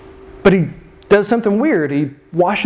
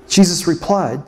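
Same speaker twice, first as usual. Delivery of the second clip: normal talking voice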